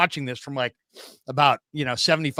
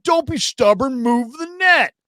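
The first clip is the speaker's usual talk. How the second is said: much higher, louder, slow mock insistence; pushy stranger; 10 semitones higher, 6 dB louder than usual. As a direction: mimic a pushy dad digging in.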